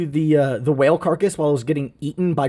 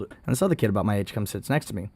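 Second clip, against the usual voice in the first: ordinary talk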